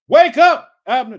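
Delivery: loud, high wake-up shout. A narrator's reading. Preacher reading David rousing the sleeping soldiers, shouted down. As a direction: call down sharply to sleepers far off. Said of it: "he shouted down to the soldiers".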